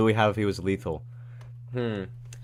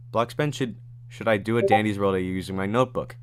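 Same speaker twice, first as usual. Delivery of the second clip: usual speaking voice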